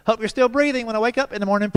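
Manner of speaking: dad's playful revenge; mock threat. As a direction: turn the kid's creepy line back on him. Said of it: "I said".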